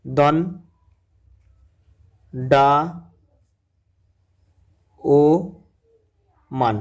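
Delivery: "দন ডা ও মান" spaced out with long pauses; split into syllables. Shown as a demonstration. Teacher breaking দণ্ডায়মান into syllables for the class, slow. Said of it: "দণ্ডায়মানটা যদি ভাঙা হয়".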